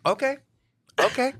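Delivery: high, short imitation of a pissed-off okay; Rupert. Friend showing Rupert his tell, mock pissed-off okay. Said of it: "when you were pissed off in a story".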